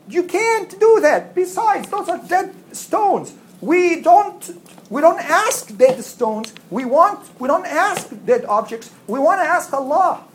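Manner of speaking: high, slow pleading; high earnest warning. Retold or imitated. Good believers warning the people.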